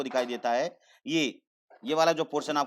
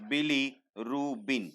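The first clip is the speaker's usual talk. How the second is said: bilirubin stretched out very slowly, each syllable apart; much slower than usual. Shown as a demonstration. Teacher drilling students on the term, very slowly. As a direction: spell out bilirubin slowly for the class. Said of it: "क्या बोलते हैं".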